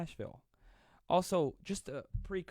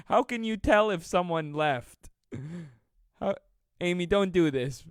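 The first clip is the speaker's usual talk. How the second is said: laughing apology; 4 semitones higher, 8 dB louder than usual. Real, not acted. Amy left after his joke.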